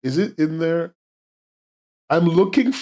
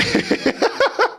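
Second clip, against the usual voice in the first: laugh. Real, not acted. Laugh after anyway.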